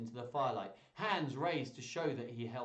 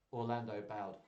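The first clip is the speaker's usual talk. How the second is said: ordinary delivery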